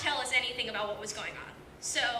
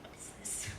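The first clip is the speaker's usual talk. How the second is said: whispered, much softer, puzzled; 11 dB quieter than usual. Retold or imitated. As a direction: whisper it in confusion. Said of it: "You're like".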